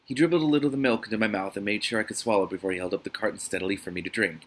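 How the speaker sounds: normal talking voice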